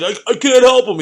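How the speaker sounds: high, loud flustered refusal; flustered cop. Retold or imitated. Rookie cop refusing the robbed kid, high and loud. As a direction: cop blurting he can't.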